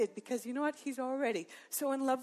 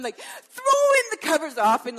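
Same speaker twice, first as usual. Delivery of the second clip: breathless excitement; 6 semitones higher, 10 dB louder than usual. Retold or imitated. Retelling a hot flash waking.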